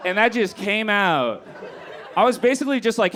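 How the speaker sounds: ordinary talk, nothing marked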